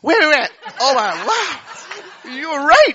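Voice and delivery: thrilled at his own face; delighted. Retold or imitated. Husband sees it in mirror.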